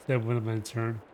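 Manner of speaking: ordinary delivery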